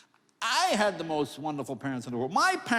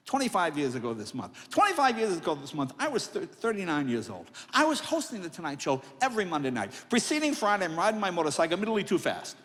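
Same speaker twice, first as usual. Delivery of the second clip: usual speaking voice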